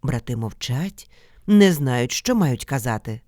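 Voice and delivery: plain reading voice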